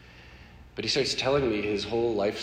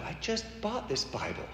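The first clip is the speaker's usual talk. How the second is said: ordinary talk, nothing marked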